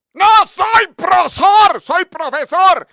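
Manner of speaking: loud, slow furious yell; angry shout. Retold or imitated. Teacher roaring at a student over his title, furious yell. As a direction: bellow it at the class.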